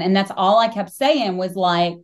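ordinary talk

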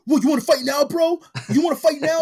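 loud, high mock taunt of a drunk picking a fight; hammed-up drunk bro wanting a fight; drunk challenge. Retelling a shirtless drunk friend challenging the Mexican dude, loud mock taunt. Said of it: "And he's like".

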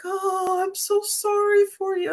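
high, syrupy imitation of pitying friends; mock sympathy. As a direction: play the people who pity you, sugary and high. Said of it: "everybody's like".

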